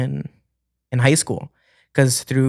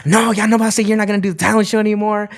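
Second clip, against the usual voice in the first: scolding mom; 7 semitones higher than usual. Retold or imitated. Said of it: "And she was like".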